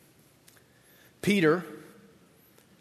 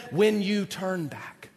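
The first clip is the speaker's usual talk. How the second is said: echoing memory; 3 dB quieter, slower than usual. Retold or imitated. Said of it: "running through his head".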